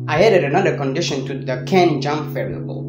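ordinary delivery

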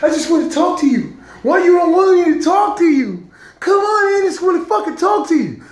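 high, loud mock whine of a nagging partner; mocking nagging girlfriend. Imitating his nagging girlfriend pleading to talk. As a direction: squeal it like she nags. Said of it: "nagging me and shit".

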